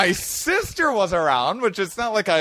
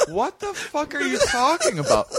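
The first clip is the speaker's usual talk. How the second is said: shocked disbelief; 11 semitones higher than usual. Retold or imitated. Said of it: "I'm like".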